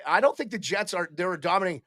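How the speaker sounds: ordinary talk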